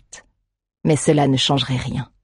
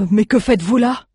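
worried question; 7 semitones higher than usual. Acted. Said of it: "s'inquiéta aussitôt le citadin".

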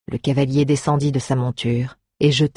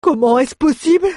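high, loud dismayed question; horrified; 12 semitones higher, 6 dB louder than usual. Acted. Dwarf, horrified, asks how it can be. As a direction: cry out in disbelief.

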